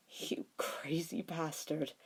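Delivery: slow, choked, breathless whisper. A narrator's reading. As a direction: barely get the words out through tears. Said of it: "he said in a choked voice".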